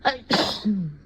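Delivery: normal talking voice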